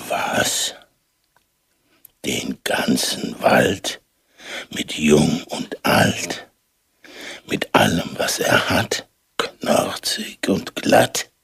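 slow frightened whispering giant; fearful whisper. A narrator's reading. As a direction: whisper it to yourself in growing fear. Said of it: "brummte der leichtgläubige Riese".

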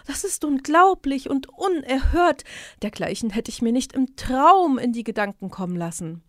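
the cat pretends to be shocked, hammed up; exaggerated fake astonishment; feigned fright. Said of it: "stellte sich erschrocken und rief".

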